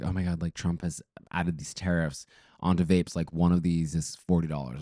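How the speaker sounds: normal talking voice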